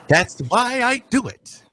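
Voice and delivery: high, lilting captain voice; captain character. Acted. Captain smugly answers the sailor.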